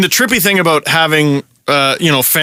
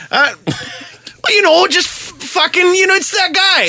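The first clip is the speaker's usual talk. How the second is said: put-on high-pitched rural Ontario accent; hammed-up rural Ontario guy; Ray from Rodney; 12 semitones higher, slower than usual. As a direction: put on a small-town Ontario hoser accent. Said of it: "turned into Ray from Rodney".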